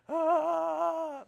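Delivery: high loud alarmed cry; a sudden yelp of fright; panicked yelp. Retold or imitated. Nervous father over every bump, a high yelp.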